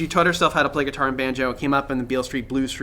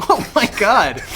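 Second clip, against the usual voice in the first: laughing; 8 semitones higher, 7 dB louder than usual. Real, not acted. Laughing at the weird word.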